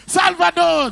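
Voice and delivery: loud high shout of the name; full-voiced fan yell; crowd shout.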